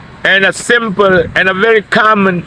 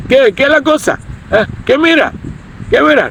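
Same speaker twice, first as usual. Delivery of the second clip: higher, aggressive street tough picking a fight; tough pachuco challenge; 7 semitones higher than usual. Shown as a demonstration. Rough guy challenging a stranger, hammed-up tough. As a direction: square up and taunt him. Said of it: "I just gave you a little demonstration".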